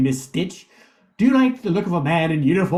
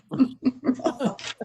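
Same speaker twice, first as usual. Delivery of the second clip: soft mock villain chuckle; a sly little villain's laugh after grumbling; 6 semitones higher, 7 dB quieter than usual. Grumpy villain leaves muttering humbug.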